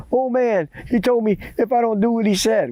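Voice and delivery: put-on high, stammering boy's report; acted high-pitched fright; panicked boy. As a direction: blurt it out high, scared and repetitive. Scared boy reports to grandma.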